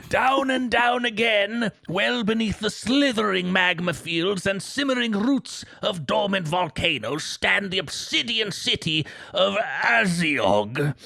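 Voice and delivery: put-on high, slow, strained old storyteller voice; Old Man Gillywinkle. Reading game lore to his co-host as a high, slow old man. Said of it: "Old Man Gillywinkle?".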